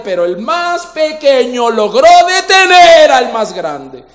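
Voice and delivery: loud, rising sermon climax; fiery preaching. Acted. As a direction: drive it home to the crowd. Preaching at full force. (predicación encendida, cada vez más fuerte)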